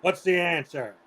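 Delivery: mild imitation of a stern math teacher quizzing the class; teacher impression. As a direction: bark out the questions like a coach calling plays. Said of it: "He had this voice like this".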